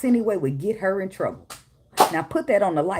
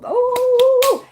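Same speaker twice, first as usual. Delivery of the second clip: long, loud, high-pitched whoop; high ooh; 7 dB louder than usual. Real, not acted. Reacting as the box gets disturbed, high ooh. Exclaims mid-sentence at the box.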